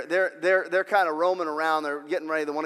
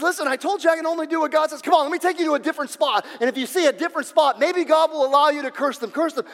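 insistent retelling; 11 semitones higher, 6 dB louder than usual. Acted. Prophet urges king, then king.

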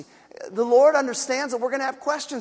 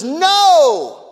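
emphatic no; 8 semitones higher, 9 dB louder than usual. Retold or imitated. Peter declares emphatically.

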